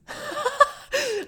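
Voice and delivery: short high burst of laughter. Real, not acted. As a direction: laugh at your own boldness.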